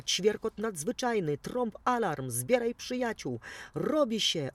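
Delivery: high, loud, eager call to arms; eager chirping call. A narrator's reading. The sparrow rallying friends, high and loud despite the whispered reply tag. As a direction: call out high and bright, raring to go. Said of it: "odszepnął wróbelek".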